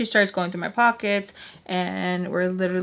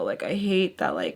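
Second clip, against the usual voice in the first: ordinary delivery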